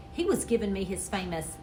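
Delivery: ordinary delivery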